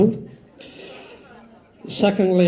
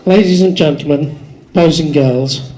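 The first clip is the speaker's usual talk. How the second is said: loud opening address; 8 dB louder than usual. Real, not acted. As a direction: project it to the back of the hall. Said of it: "thought I was gonna have to shout".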